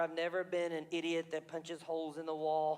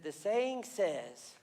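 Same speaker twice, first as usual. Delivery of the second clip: slow solemn reply; much slower than usual. Acted. [name] begins slowly.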